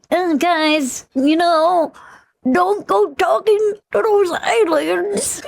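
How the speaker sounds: high, slow put-on Elon voice; Elon impression. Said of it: "your impressions are spot".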